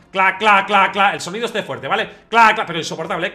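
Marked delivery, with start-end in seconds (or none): 0.1-1.1 loud imitated clacks of the scanner; machine clacking. Imitating the MRI machine's banging for viewers, loud clacks. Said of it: "empezaba a sonar". 2.3-2.6 loud imitated clacks of the scanner; machine clacking. Imitating the MRI machine's banging for viewers, loud clacks. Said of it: "empezaba a sonar".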